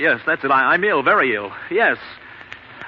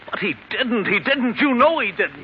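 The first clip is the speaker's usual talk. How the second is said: higher and higher, pleading; frantic insistence; 8 semitones higher than usual. Acted. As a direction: insist desperately, rising each time. Insisting friend wasn't suicide.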